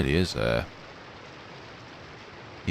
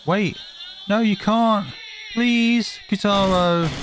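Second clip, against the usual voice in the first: desperate plea; 11 semitones higher, slower than usual. Acted. Girl begs Kotaro to stay, high and desperate. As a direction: beg him not to go. Begging friend not to leave.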